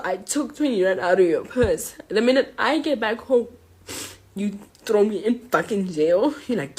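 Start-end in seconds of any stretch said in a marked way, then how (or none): none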